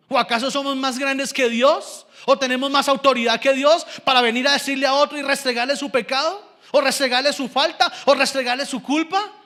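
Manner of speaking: insistent pulpit challenge; challenging preaching. Real, not acted. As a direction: fire the questions at the congregation. Preaches at full force.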